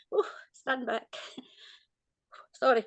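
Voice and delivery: real emotion, half laughing it off; welling up and laughing through it; choked-up laugh. Real, not acted. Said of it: "He's made me feel quite emotional".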